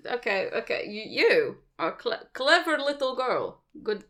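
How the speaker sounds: a lightly put-on Russian accent for a mafia boss; mock Russian gangster, half-hearted accent; bad Russian accent. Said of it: "Sorry for the accent".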